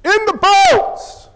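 high, drawn-out shouted order; acted boss's holler; boss yelling. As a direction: yell it across the dock like a boss. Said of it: "Joe's boss yells".

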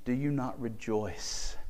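usual speaking voice

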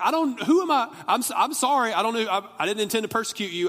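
high, loud stammering panic; flipping out. Acted. Saul apologizing to the voice from the light, flipping out. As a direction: blurt a frantic apology, easing down toward the end. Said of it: "He's flipping out like".

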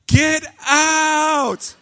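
loud, high-pitched yell of get out; startled group shout, acted out at full volume; yell in unison. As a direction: yell it out as if throwing out an intruder. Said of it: "we all yell".

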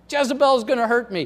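high, fast, loud whine; mock whining. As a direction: imitate a panicked pessimist whining. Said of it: "he's whining".

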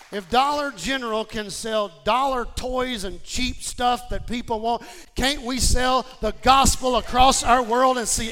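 rally the congregation at full voice; impassioned preaching. Acted.

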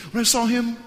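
high, loud, showy burst; mock excitement. Pastor describes seeing the celebrity, mock excited. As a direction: hit it big, as if star-struck.

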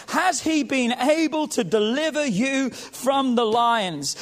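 slow, drawn-out pleading question; lamenting plea. Acted. The king pleads toward the den, slow and lamenting. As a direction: beg for an answer you fear. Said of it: "a voice of anguish".

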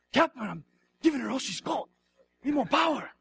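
a hint of a straining Star Trek engineer. Preacher mimics an old Star Trek engineer to his congregation, lightly hammed-up. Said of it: "It's like an old Star Trek".

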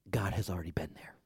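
hushed unvoiced whisper. Pastor whispers to his congregation, hushed. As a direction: breathe it out like a secret.

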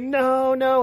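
high imitated toddler wail; retold screaming. Dad imitating daughter reminded of promise, high wailed refusal. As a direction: cry out in mock refusal, high-pitched. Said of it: "she's, like, screaming".

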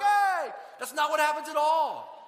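loud, high, drawn-out protest; indignant shout. Acted. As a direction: shout a comic objection as doubting Thomas. Thomas's indignant protest, acted out.

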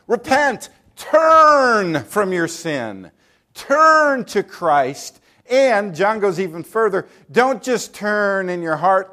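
loud, slow, stretched call to repent; fervent preaching. Acted. Preacher calling sinners to repent, loud and slow. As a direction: drag each word out like an altar call.

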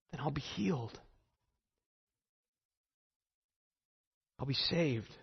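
soft inward murmur, repeating the hope; hushed, pleading self-talk; murmured to herself. Retold or imitated. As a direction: say it under your breath to yourself. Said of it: "she kept saying to herself".

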